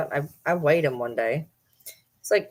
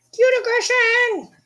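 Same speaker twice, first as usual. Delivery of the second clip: drawn-out, very high squeal; cute aggression; 4 dB louder, slower than usual. Real, not acted. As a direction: let affection burst out in a long squeal.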